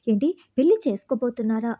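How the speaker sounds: ordinary delivery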